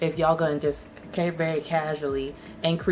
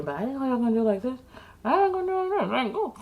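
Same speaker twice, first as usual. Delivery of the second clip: mock whine; 7 semitones higher, faster than usual. Mock-whining cold open.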